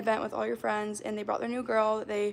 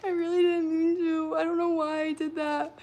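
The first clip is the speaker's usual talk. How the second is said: higher, pleading take of the apology; 6 semitones higher than usual. Acted. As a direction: plead with a lifted voice. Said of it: "Okay, scene".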